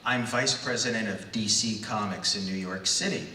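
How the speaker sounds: ordinary talk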